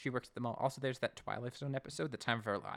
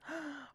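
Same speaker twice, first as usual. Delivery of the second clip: gasp; 8 dB quieter than usual. Pretend. Mock shock at apples.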